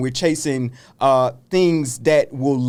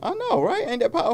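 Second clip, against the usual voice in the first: excited; 3 dB quieter, faster than usual. Real, not acted. Proud of her own saying.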